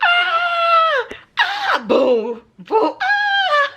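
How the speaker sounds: high frantic screams of panic; retold screaming. Screaming at a cockroach crawling toward her. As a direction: shriek and holler like the roach is charging. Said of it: "I'm screaming 'cause it starts coming".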